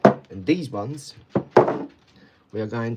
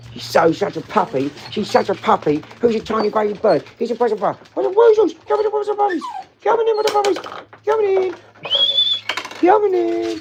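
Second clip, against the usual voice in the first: very high, loud doggy voice; baby talk to puppy; 10 dB louder than usual. Acted. Owner cooing at the puppies to come in, very high baby talk. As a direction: squeal pet names and call them in.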